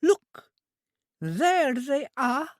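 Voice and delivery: put-on high, slow simple girl's voice; an eager, innocent young woman; high, drawn-out childlike voice; childlike Trudy. A narrator's reading. Trudy points excitedly.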